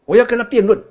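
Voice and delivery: mock defiant shout; defiant challenge. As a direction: throw down the challenge proudly. Brahmin insists on debating.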